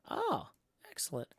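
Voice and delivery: normal talking voice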